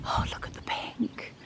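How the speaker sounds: hushed whisper to the camera. Real, not acted. Vlogger whispering while admiring flowers. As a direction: whisper so as not to disturb the garden. Filming quietly in the garden.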